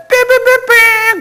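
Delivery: high, loud mimicked cock-crow; rooster crow. Preacher imitating the cock crowing three times, shrieked.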